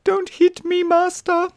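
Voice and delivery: high, pleading mock whimper; cowering dog. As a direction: beg like a scared puppy. Playing guilty dog, cowering.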